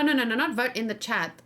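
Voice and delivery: normal talking voice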